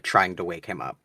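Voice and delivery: usual speaking voice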